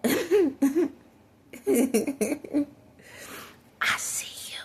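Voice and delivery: hushed, teasing whisper; mock-creepy whisper. Vlogger teases the man she is watching, creepy whisper. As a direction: whisper like a movie ghost-seer.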